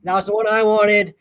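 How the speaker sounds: playfully aggrieved complaint; hammed-up sore loser; mock upset. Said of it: "he instantly gets mad".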